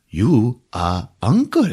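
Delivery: slow, stiff alien English; deliberate, formal creature speech. Retold or imitated. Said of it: "says to him in perfect English".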